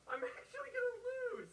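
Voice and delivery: very high, loud cry of panic; alarmed, high-pitched outburst; panicked wail. Real, not acted. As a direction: wail it out in a sudden squeal. Panicking at losing the game.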